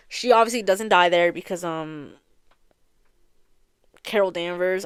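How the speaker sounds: ordinary talk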